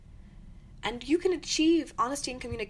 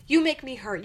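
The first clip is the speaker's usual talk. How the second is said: louder accusing you statement; mock blaming; 3 semitones higher, 8 dB louder than usual. Shown as a demonstration. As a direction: jab the blame at them. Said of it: "As opposed to".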